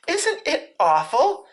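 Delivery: slow, high-pitched dread; frightened Piglet. A narrator's reading. Piglet holding tight to Christopher Robin's hand, high and slow with dread. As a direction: draw it out in a high little voice. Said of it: "said Piglet".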